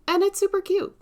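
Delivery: high gushing delight; excited. Real, not acted. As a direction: squeal how cute it is. Gushing over kit.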